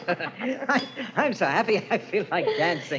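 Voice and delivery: giggling through the words; laughing. Acted. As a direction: laugh as you say it. Laughs while speaking.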